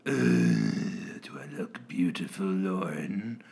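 low creepy villain voice. Pretend. Acting creepy masked villain.

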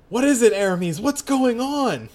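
high, loud worried call; alarmed calling. Acted. Heroine calls to friend above, alarmed.

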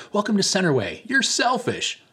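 high, bright mock-cheery greeter voice; mock church welcome. Pastor mocking a church greeting to the congregation, high mock-cheery voice. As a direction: parody a chirpy welcome that turns blunt. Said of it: "it sounds super cozy".

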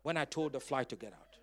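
ordinary talk

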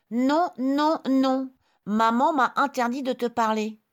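put-on high, loud little girl refusing. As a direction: refuse firmly as a small child.

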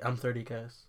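flat, quiet and unexcited; deadpan mumble. Shown as a demonstration. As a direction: say it like you don't care. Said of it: "I'm gonna be like".

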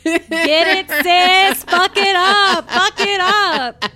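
slow, hammed-up hype chant; hype cheering. Real, not acted. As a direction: cheer her on like a hype squad. Cheering on the reader.

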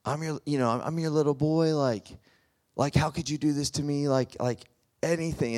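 high, hurt plea to his mother; pleading son. Retold or imitated. Son pleading at his mother's locked door, high and hurt. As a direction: beg like a wounded little boy. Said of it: "and he's like".

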